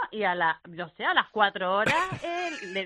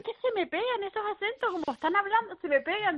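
mimicking the husband; 11 semitones higher than usual.

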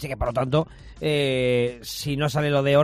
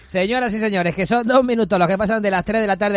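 radio announcer; 3 semitones higher than usual. Acted. Reopens show, announces headlines.

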